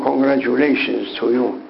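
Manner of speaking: ordinary talk, nothing marked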